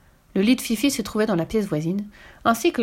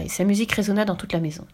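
ordinary delivery

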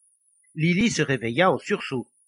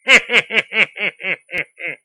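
loud, higher staccato cackle; devilish snicker; 5 semitones higher, 10 dB louder than usual. A narrator's reading. Devil mocking Lily's refusal, staccato snicker. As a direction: snicker like a wicked little devil. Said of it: "Le diable ricana".

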